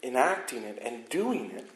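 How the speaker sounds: fiery sermon build; preaching at full force. Acted. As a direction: drive it home from the pulpit, rising and loud. Preaching climax on the law.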